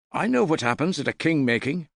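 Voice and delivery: put-on high, fast, keen boy's voice; a young lad bursting to share his news; eager young lad. A narrator's reading. Young man telling the hall about a king-making, eager and high.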